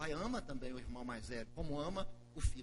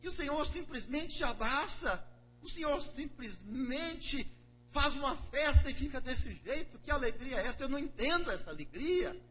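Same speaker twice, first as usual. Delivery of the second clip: higher and louder, aggrieved; resentful older brother; 9 semitones higher, 8 dB louder than usual. Acted. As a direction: pour out the grievance to father. Broer klaagt bij vader.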